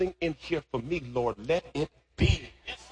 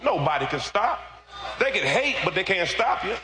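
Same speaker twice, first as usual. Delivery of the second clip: full-force preaching; 9 semitones higher than usual. Real, not acted. Preacher rousing the congregation about God's favor, full-force preaching. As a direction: preach it out high and hard.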